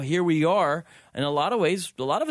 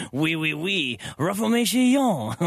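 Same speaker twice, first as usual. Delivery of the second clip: put-on John Calvin French accent; 2 semitones higher, slower than usual. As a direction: proclaim Reformation like a mock Frenchman. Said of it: "That was my John Calvin impersonation".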